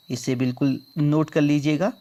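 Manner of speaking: ordinary delivery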